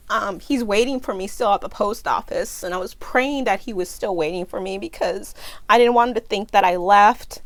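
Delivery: ordinary talk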